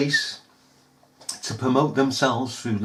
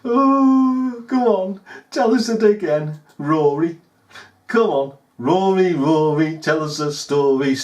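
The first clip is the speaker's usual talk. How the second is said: taunting; 2 semitones higher, 4 dB louder than usual. Real, not acted. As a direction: goad him to tell it. Taunting Rory loudly.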